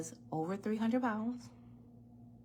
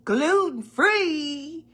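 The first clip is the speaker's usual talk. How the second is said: mock-puzzled customer, higher and louder; slow drawn-out mock disbelief; 8 semitones higher, 9 dB louder, much slower than usual. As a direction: stretch out gluten-free like a baffled shopper. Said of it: "People like".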